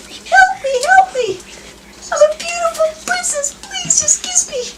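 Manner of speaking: shrill little frog begging to be kissed; frog princess voice. Retold or imitated. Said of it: "pretty soon the frog goes".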